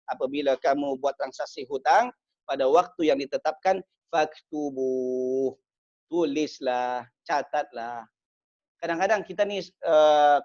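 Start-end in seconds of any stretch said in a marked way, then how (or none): 4.1-5.6 very slow drawn chant of Faktubuh; slow melodic recitation; Quran recitation. Acted.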